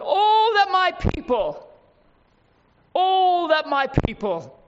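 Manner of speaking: high, pleading cry of God; the Lord's yearning plea. Acted. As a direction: plead as the Lord longing for his people. Preacher voicing the Lord's plea.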